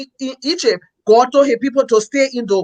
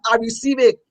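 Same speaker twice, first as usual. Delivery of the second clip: ordinary talk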